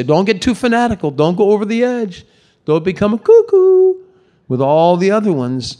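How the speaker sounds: high, fast mocking critic voice; scoffing critic. Critic mocking a worshipper as fanatical, high and mocking. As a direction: lampoon the naysayer, pitch way up on cuckoo. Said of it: "there's somebody who will say".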